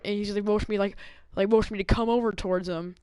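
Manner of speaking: normal talking voice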